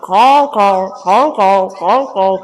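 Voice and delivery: repeated, even raven caws; croaking raven. Acted. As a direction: caw steadily like a croaking raven. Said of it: "starts croaking".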